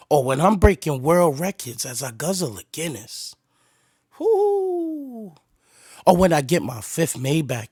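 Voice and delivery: low, soft lyric reading. Acted. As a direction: read the verse low and flat. Reads [name]'s verse aloud.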